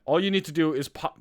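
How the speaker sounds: normal talking voice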